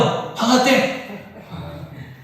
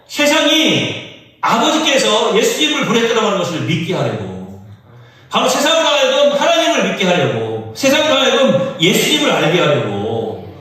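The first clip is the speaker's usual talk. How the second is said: preaching at full force; 7 semitones higher than usual. Acted. Pastor urging the church about its purpose, fervent preaching. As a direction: preach it out, building to a cry. Sermon climax, rising fervor.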